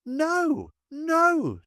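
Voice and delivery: a frantic, high-pitched wild shout. A narrator's reading. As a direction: scream the refusal, out of control. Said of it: "Jamaica shouted wildly".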